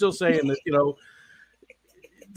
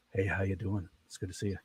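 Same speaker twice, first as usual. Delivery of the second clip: much softer and lower; quiet drunk voice; 4 semitones lower, 10 dB quieter than usual. Shown as a demonstration. Host imitates greeting people when drunk, soft and low. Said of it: "my voice goes way down".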